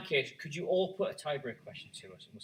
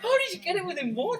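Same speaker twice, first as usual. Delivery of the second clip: high incredulous shout; stunned, yelling disbelief; shocked disbelief; 8 dB louder than usual. Real, not acted. Disbelief at rival's close guess.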